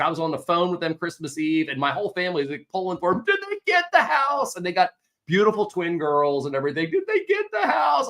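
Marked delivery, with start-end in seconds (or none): 3.2-4.6 much higher, excited asking; the whole family dying to know the outcome; family on Christmas Eve eagerly asking for news. Retold or imitated. Family pulling for the clients. 6.9-8.1 much higher, excited asking; the whole family dying to know the outcome; family on Christmas Eve eagerly asking for news. Retold or imitated. Family pulling for the clients.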